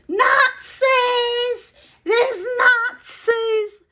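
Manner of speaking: put-on very high, loud child's squeal; child calling out. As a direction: imitate a little girl shrieking out an accusation. Child points and calls out, retold.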